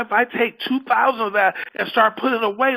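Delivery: ordinary talk, nothing marked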